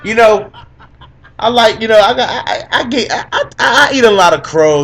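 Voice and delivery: laughing. Real, not acted. Admits wrong picks, laughing.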